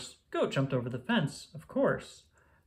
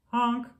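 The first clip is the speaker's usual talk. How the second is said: scared honk; 9 semitones higher than usual. Acted. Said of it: "cried the dump".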